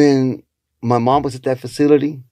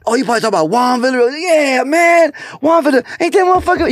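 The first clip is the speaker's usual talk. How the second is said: much higher, fast and thrilled; excited quote; 12 semitones higher than usual. Retold or imitated. Friend recalling Juan Villa, fast high excited voice. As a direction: gush about a favorite comic, quick and high. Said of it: "He said".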